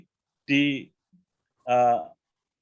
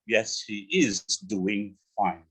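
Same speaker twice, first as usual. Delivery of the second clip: slow full form; 3 semitones lower, much slower than usual. Shown as a demonstration. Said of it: "she's doing fine".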